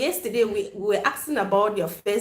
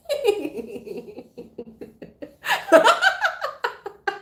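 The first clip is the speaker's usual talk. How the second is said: real shrieking laugh; long high cackle. Real, not acted. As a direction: fall apart laughing. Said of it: "I'm laughing so much".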